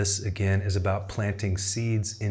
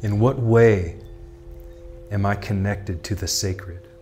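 measured, projected contemplative questions and affirmations; meditation prompts. Acted. Tarot guide voicing the listener's inner questions, solemn meditative delivery. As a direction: pose each thought deliberately, then leave long silence.

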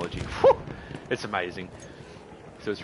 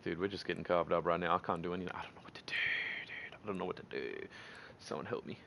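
very soft, defeated mumble; helpless muttering; 5 semitones lower, 11 dB quieter, faster than usual. Real, not acted. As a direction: trail down to a near whisper, pleading for help. Losing badly, hopeless in game.

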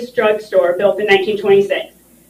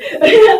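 high, choked laugh through tears; a laugh that breaks into crying; tearful laugh; 10 semitones higher, 6 dB louder than usual. Real, not acted. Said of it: "I'm getting emotional".

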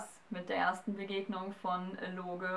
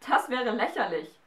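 higher, louder mocking retort; mocking correction; 4 semitones higher, 7 dB louder than usual. A narrator's reading. Galatea corrects the giant, mocking and louder. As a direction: throw his words back at him. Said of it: "verbesserte Galatea ihn".

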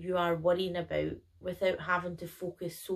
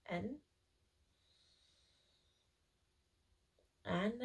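guided meditation; 8 dB quieter than usual. Acted. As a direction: gently guide each breath in and out. Leads a breathing meditation.

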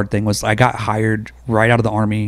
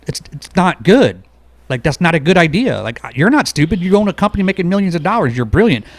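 higher and louder, pressing the point to the boss; emphatic retold pushback; 6 semitones higher, 3 dB louder than usual. Explaining to his boss why the idea is bad, raised emphatic voice.

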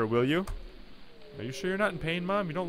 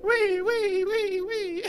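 high little squeals of wee; playful child; much slower than usual. Pretend. Fluffy walking around cutely, playful high squeals. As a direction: squeal along like a happy toddler.

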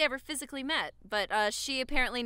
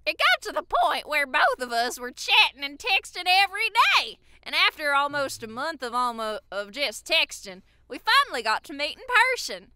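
higher, exaggerated Southern twang; Southern accent; 3 semitones higher, 7 dB louder than usual. Shown as a demonstration. Reading a fan's Tinder backstory, hammed-up Southern accent. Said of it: "read it in a Southern accent".

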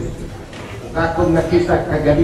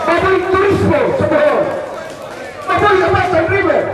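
rally speech; 11 semitones higher, faster than usual. Real, not acted. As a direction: pump up the crowd, quick and high. Chanting slogans to close the speech. (paspas nga singgit sa rali)

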